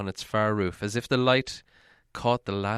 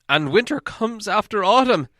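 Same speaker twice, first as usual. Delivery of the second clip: loud, higher grumbling dwarf; Bifur, glum dwarf; 9 semitones higher, 6 dB louder than usual. Acted. Bifur adding to the dwarves' grumbling, loud and glum. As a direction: pile on the gloom with a raised voice. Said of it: "said Bifur".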